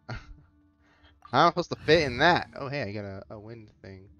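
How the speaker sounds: ordinary talk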